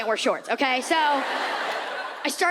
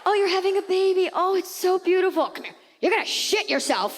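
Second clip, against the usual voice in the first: hammed-up sweet cooing, pitched up; mock gushing, higher; 7 semitones higher than usual. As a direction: gush like a sugary friend, then drop the truth. Mock gushing about pregnancy.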